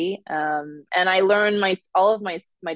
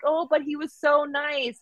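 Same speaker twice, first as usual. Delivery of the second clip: put-on mom's voice, higher Minnesota accent; Minnesota nice accent; 10 semitones higher than usual. Mom defends the nice banker, higher Minnesota accent. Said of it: "dial up that accent".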